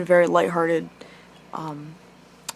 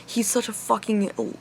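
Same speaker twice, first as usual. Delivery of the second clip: ordinary talk, nothing marked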